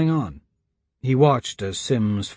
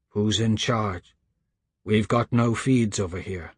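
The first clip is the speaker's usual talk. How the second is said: low, subdued, measured questioning; grave caller; 3 semitones lower than usual. A narrator's reading. Stranger on headset questions Lucas about Bernard, low and grave.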